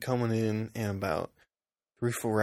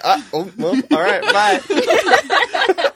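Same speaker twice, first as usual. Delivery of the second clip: awkward goodbye; 10 dB louder, much slower than usual. Retold or imitated. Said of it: "He's like".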